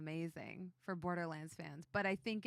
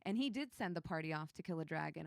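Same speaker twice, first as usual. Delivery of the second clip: ordinary talk, nothing marked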